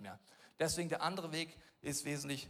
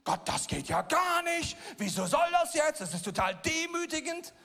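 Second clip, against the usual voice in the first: higher, louder exasperated outburst at God; indignant rant; 12 semitones higher, 9 dB louder than usual. Retold or imitated. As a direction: pastor ranting at God while collecting bottles. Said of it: "reg ich mich auf".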